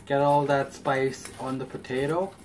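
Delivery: ordinary talk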